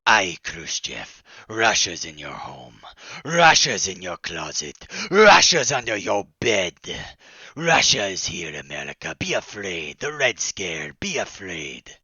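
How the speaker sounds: low, ominous doomsday announcer, hammed up; mock Red Scare voice. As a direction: spook them like a cold war propaganda reel. Mocking Russia scare as doom announcer.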